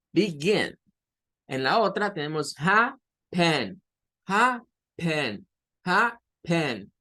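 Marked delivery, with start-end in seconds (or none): 0.1-0.8 Begin, voice going up on GIN; stress on the second syllable. Shown as a demonstration. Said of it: "en la gen se sube el tono de voz". 2.6-6.9 happen, with HA strong and PEN weak; weak last syllable. Shown as a demonstration. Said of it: "Entonces la última es débil".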